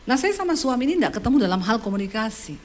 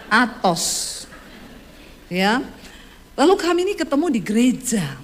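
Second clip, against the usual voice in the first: normal talking voice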